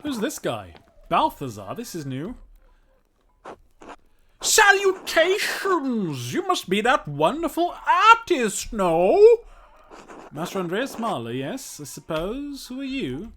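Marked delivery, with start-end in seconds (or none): 4.4-9.2 high, loud, theatrical greeting; booming showman voice; flamboyant eccentric. Acted.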